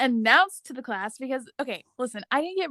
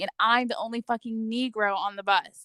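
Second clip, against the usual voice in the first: ordinary talk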